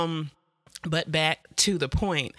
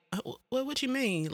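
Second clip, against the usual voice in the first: confused; 9 semitones higher, 4 dB quieter than usual. Retold or imitated. Confused reply to strangers.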